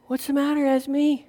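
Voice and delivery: put-on soft, gentle question to a crying child; soothing grandpa. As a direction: coax a little one tenderly.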